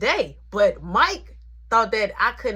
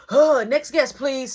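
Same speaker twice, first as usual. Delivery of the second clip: louder, higher mock-chipper call for the next guest; sly remark; 5 semitones higher, 7 dB louder, slower than usual. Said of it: "sly remarks".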